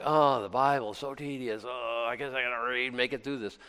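weary, droning groan of tedium; bored complainer. Acted. Preacher mimics listeners who find the Bible tedious, weary bored groan. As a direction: softly moan it like a reluctant reader. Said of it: "Some of you are like".

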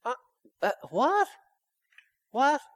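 high, puzzled what; incredulous high questioning; mock bewilderment. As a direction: act baffled at the fretting. Mocking the worrier.

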